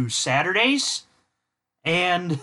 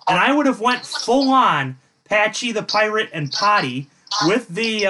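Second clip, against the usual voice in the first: normal talking voice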